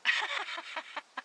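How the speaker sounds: high, rippling giggle; amused laughter. Acted. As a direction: burst into a long, high laugh. Amused by the turtle's name.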